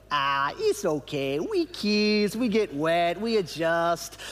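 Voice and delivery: put-on slow, playful Nicaraguan English accent; quoting the team's catchphrase in a Nicaraguan English accent; mock Nicaraguan English accent. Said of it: "somebody would inevitably say".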